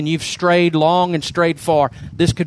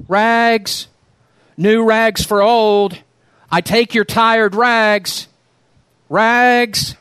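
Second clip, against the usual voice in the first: higher, louder, slow drawn-out vendor's cry; clear tenor call; 6 semitones higher, 3 dB louder, much slower than usual. Acted. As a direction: sing it down the alley, slow and ringing. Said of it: "calling in a clear tenor voice".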